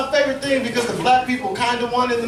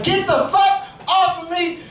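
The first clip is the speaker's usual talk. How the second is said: mild mock scream; 7 semitones higher, slower than usual. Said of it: "she starts to scream at me".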